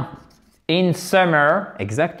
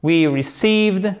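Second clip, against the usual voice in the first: slow received, long EE in the second syllable; stress on second syllable; 3 semitones higher, slower than usual. Shown as a demonstration. Teacher modelling slowly, stress on second syllable of received. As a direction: lean on CEIVED in received. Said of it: "Accent tonique sur la seconde syllabe".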